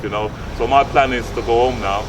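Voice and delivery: ordinary delivery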